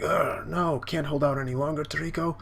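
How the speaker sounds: high, hurried mock struggle; hammed-up straining cry; acted desperate strain. Acting out struggling character in fight.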